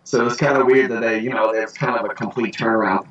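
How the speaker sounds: normal talking voice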